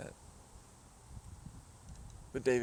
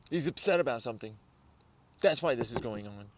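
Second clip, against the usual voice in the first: louder pointed jab; loud teasing; 8 dB louder than usual. Real, not acted. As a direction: call it out to needle him.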